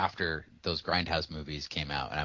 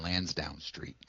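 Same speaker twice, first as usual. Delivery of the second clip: whisper; 4 semitones lower, 7 dB quieter, slower than usual. Real, not acted. Joking about feeling offended.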